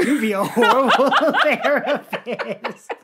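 loud, slow words broken by laughter; helpless laughing tease. Real, not acted. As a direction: crack up while teasing. Cracking up at co-host's bit.

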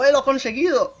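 high, loud mock celebration; triumphant viewers. Acting out viewers thinking he made it, mock triumph. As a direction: imitate fans cheering a success. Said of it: "Y vosotros pensando".

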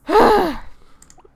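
big, loud, high frustrated huff; loud exasperated sigh. Real, not acted. Fed up with the sims, a loud exasperated sigh.

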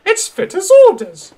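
very high, loud haughty insistence; defiant. Acted. Daisy argues with her driver and staff, defiant. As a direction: insist shrilly, pulling rank.

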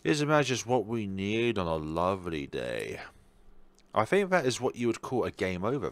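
ordinary talk, nothing marked

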